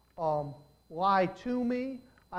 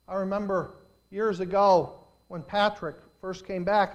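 ordinary talk

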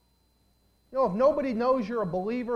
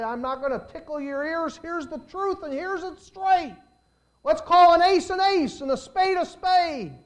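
hard-hitting preacher, high and building to loud; Charles Stewart; 9 semitones higher, 8 dB louder than usual. Retold or imitated. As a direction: cut loose like a straight-talking preacher, voice climbing. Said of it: "Charles Stewart would get up and say".

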